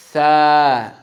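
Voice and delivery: Tha with the tongue shown between teeth, loud. Shown as a demonstration. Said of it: "Your tongue needs to be out".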